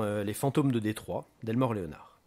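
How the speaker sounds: plain reading voice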